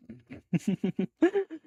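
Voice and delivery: high giggle climbing in pitch; stuttering high-pitched laugh; real laugh. Real, not acted. Laughs at chat.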